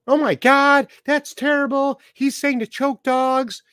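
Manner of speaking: high, slow, pearl-clutching mock outrage; a hammed-up scandalized voice; mocking critics. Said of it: "mocking critics' outrage".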